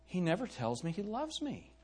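softly voiced mock wifely complaint; soft, lightly acted grievance; complaining wife. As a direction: quietly play the wronged wife in a joke. Wife complains to the preacher in joke.

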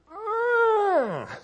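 long loud high cry of alarm; desperate yell. Retold or imitated. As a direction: cry out for help underwater. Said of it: "And I went".

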